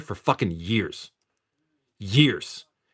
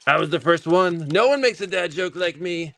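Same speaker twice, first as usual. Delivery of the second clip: higher-pitched put-on boastful voice; mock braggart; 8 semitones higher than usual. Dad jokingly crowing about his dad jokes, mock boasting. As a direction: brag in a hammed-up high voice.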